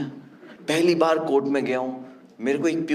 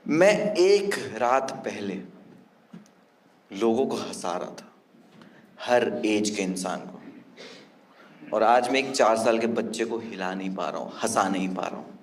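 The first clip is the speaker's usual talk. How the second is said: subdued sadness; 5 semitones lower, 3 dB quieter than usual. Real, not acted. Remembering the scared child, quietly saddened. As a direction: let the hurt sink in.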